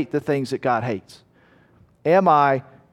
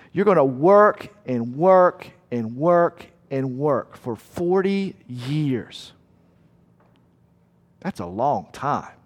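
weary drawn-out; slower than usual. Acted. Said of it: "sermon emphasis on weary years".

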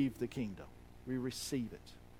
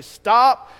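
loud, high barked stop; sharp command; 6 semitones higher, 15 dB louder than usual. Acted. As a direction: bark it like a gatekeeper. Disciples voiced forbidding.

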